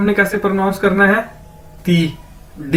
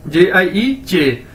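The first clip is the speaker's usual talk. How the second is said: जे आई ई चे spelled out slowly, letter by letter; slow spelling-out; much slower than usual. Shown as a demonstration. Teacher spells the pinyin for students, slowly letter by letter. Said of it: "चे, ठीक है".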